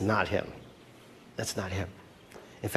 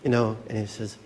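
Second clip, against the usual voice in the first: usual speaking voice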